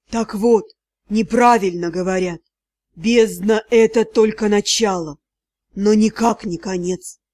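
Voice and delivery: deep, low creature voice; sea god. A narrator's reading. Sea god lectures the drowning heroine. (низкий голос морского бога)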